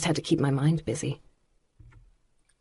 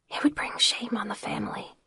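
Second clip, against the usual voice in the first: flat hushed whisper; 5 semitones higher, 8 dB quieter than usual. A narrator's reading. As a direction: whisper it back, resigned. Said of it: "Lana whispered back".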